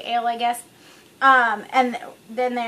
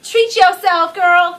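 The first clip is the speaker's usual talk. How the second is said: loud, high, sassy cheer; sassy treat yourself; 5 semitones higher, 7 dB louder, much slower than usual. Retold or imitated. To herself at the liquor store, a loud sassy cheer. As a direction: belt it out like hyping up a friend. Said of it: "I was just feeling very much like".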